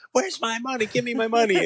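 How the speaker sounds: high, hammed-up demand for money; mock screaming thug. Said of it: "screaming at him".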